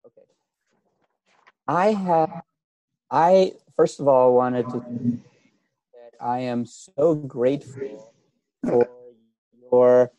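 mic cutting in and out; poorly connected microphone; fading in and out. Real, not acted. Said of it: "you're fading in and out a little bit".

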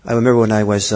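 ordinary talk

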